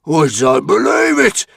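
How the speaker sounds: loud, high, strained Viking roar. A narrator's reading. A Viking on the cliff watching the king, roaring. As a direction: bellow in disbelief. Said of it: "roared Very Vicious the Vizzy Thug".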